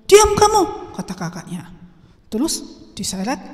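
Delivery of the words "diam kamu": high, loud scolding order; angry command. Acted. Older brother silencing pleading younger brother, angry command. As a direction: shut them up sharply.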